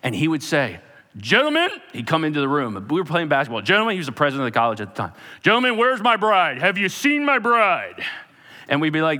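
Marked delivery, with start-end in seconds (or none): 1.2-1.7 booming, high rising call; loud, commanding hail; big bold mentor. Retold or imitated. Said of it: "He talked like this too". 3.6-3.9 loud, high rising hail; booming president's summons; big bold mentor. Retold or imitated. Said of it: "He talked like this too". 5.4-8.0 booming, high-pitched public call; loud, grand presidential holler; big bold mentor. Retold or imitated. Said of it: "He talked like this too".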